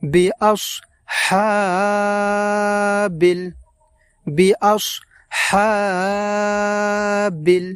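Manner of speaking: বিআসহাবিল with the হা held long; long vowel drawn out. Shown as a demonstration. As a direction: stretch the হা in বিআসহাবিল slowly. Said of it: "লম্বা উচ্চারণে টান দিয়ে পড়তে হবে". (বিআসহাবিল, হা লম্বা টান দিয়ে)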